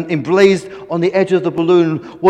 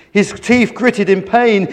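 plain reading voice

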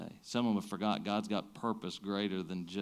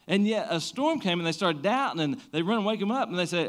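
rising, louder preacher's build; preaching at full force; 9 semitones higher, 4 dB louder than usual. Acted. Pastor preaching the storm story to the congregation, full force. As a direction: preach it up to the disciples' cry.

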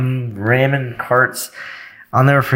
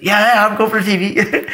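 eager fake yes; 9 semitones higher than usual. Eager shopper lying, retold.

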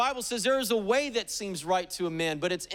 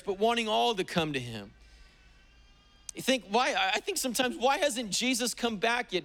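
ordinary delivery

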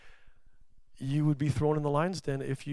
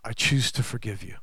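tender forgiveness; 5 semitones lower, 5 dB quieter than usual. Retold or imitated. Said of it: "God's saying to you".

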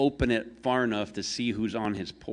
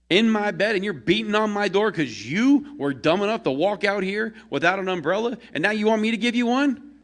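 much higher and louder, indignant yelling; angry rant; 12 semitones higher, 6 dB louder than usual. Acted. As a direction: scold her for waking him. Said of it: "The angry man inside is like".

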